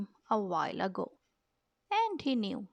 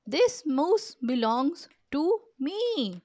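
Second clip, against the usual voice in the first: firm claim; 6 semitones higher, 4 dB louder, slower than usual. Acted. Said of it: "he explained".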